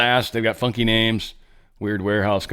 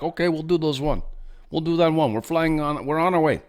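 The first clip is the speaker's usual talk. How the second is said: higher, lightly put-on Ukrainian accent voicing the delegation; a mock Ukrainian official rushing to agree; bad Ukrainian accent; 6 semitones higher than usual. Ukraine's team, voiced by the host, agreeing to everything, in a bad accent. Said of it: "Bad accent".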